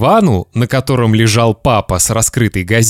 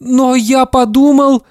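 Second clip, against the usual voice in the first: put-on very high, scared child voice; frightened boy; 14 semitones higher than usual. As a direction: protest timidly to an angry dad. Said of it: "испуганно сказал Славик".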